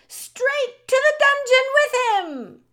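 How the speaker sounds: piercingly high, loud mob demand; crying out. A narrator's reading. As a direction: yell it from the back of the crowd. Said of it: "a voice cried out".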